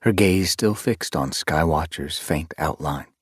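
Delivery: ordinary delivery, nothing marked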